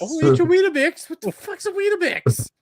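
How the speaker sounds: high, loud mocking impression; high mock British voice. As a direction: squeak it out mockingly. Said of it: "Pop talks about".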